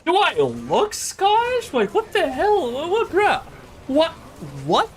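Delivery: can't believe what he just heard; outraged disbelief. Real, not acted. Friend recounts being mistaken for Scottish, outraged high disbelief.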